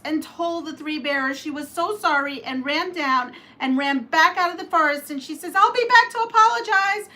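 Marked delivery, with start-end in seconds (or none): 5.6-7.1 high, loud, fast hurried promise; frightened girl. Acted. Curly Locks fleeing the bears, high hurried call. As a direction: call it back while running off.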